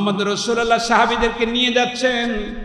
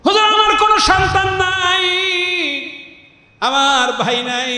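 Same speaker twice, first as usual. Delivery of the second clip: high chanted cry, dropping after the first phrase; plaintive plea; 10 semitones higher than usual. Acted. Woman tells Hujur she has nobody to send, plaintive plea. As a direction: draw out each lack as a lament.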